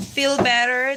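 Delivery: ordinary talk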